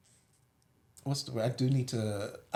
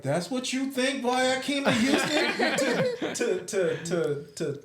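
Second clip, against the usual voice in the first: high, laughing mock outrage; mock indignant relative; 11 semitones higher, slower than usual. Imitating siblings answering her claim, laughing. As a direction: play the offended sibling, half laughing.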